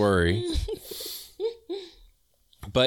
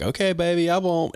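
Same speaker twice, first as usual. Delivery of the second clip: sweet-talking; 7 semitones higher than usual. Retold or imitated. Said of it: "And he's like".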